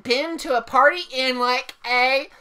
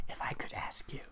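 teasing whisper; 20 dB quieter than usual. Acted. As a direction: lean in and tease. Friend teasing about crush.

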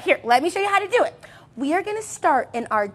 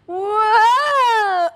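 high loud whoa; playful exclamation; 7 semitones higher, 4 dB louder than usual. Acted. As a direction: cry out as you roll. Rolling back, cheering.

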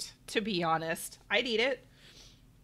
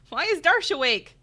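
ordinary talk

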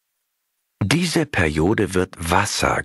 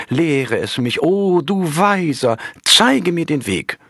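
put-on higher, begging young monk; pleading young monk; 7 semitones higher than usual. A narrator's reading. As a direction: beg the master humbly for his teaching. Junger Mönch fleht den Meister an. (hoch, flehend, als junger Mönch)

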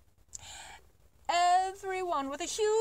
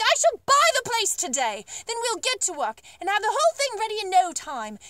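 higher, louder eager declaration; excited cry; 6 semitones higher, 7 dB louder than usual. Acted. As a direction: announce it with bold glee. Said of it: "he cried, waving his stick".

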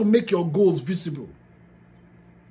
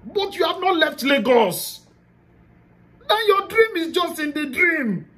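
rising emphasis; 9 semitones higher than usual. Real, not acted. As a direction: drive the point home with a raised voice. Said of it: "closing warning".

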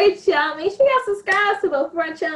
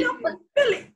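put-on Philly accent; 11 semitones higher, much faster than usual. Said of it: "from the accent".

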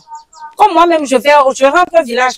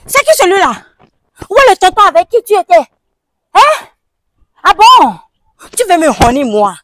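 high, loud, indignant demands; angry mother; 6 semitones higher, 4 dB louder than usual. Acted. Mother to daughter about the stranger, loud and outraged. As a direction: rear up at your daughter in outrage.

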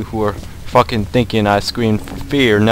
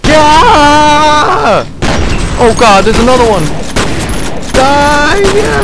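much higher, much louder battle yells; frenzied shouting; 15 dB louder, slower than usual. Real, not acted. Gamer fighting off more monsters, frenzied yelling. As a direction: roar through the fight.

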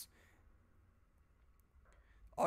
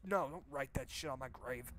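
usual speaking voice

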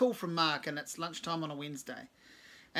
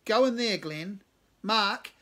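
loud command; 5 semitones higher, 9 dB louder, slower than usual. A narrator's reading. Cave commanding loudly.

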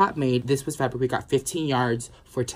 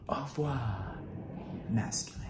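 whispered farewell; 9 dB quieter than usual. Pretend. Dramatic farewell to the beard.

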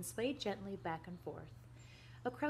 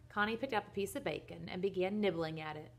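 usual speaking voice

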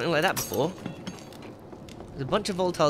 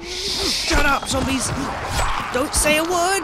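panicked yelling; 10 semitones higher, slower than usual. Real, not acted. Gamer yelling at noisy zombies, high frantic shout.

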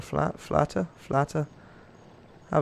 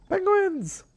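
excited cry. Real, not acted. Announcing the next animal.

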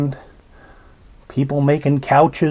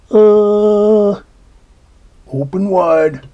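doctor voice; 5 semitones higher, 4 dB louder, much slower than usual. A narrator's reading. Doctor examining.

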